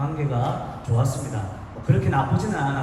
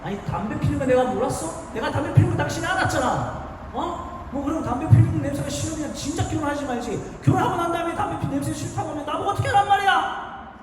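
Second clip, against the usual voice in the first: angry shout; 11 semitones higher than usual. Retold or imitated. Husband yelling at pregnant wife about smoking, furious shout. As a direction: blast her with rage, voice climbing. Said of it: "화를 내면서 소리를 지르면서".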